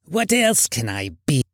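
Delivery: put-on irritated old miser's retort; crotchety Scrooge voice; grumpy uncle. A narrator's reading. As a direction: snap back as a cross old uncle. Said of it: "returned the uncle".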